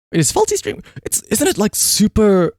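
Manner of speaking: sudden high surprised question. Real, not acted. Streamer spotting a friend online while picking a raid, surprised. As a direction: catch it mid-thought, pitch jumps up.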